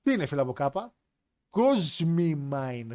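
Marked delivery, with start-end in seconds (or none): 1.5-2.9 κόσμημα dragged out very slowly, each syllable set apart; slow emphatic answer. Shown as a demonstration. Answering the friend's riddle, slow and pointed. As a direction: spell out κόσμημα slowly like it's obvious. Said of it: "Δεν υπάρχει λέξη από κάπα".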